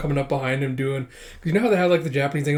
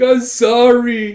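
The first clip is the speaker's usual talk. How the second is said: high, loud, drawn-out apology; mock tearful wail; 10 semitones higher, 10 dB louder, much slower than usual. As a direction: blubber it at full volume. Crying player on video call.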